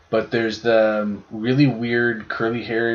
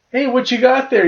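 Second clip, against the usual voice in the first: much higher cheery parent voice; parent asking the kid; 13 semitones higher, 4 dB louder than usual. Retold or imitated. Retelling a viral video to a friend, high cheery parent voice. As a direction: play the sweet curious parent. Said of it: "And they're like".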